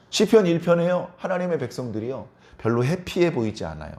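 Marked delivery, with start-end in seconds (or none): none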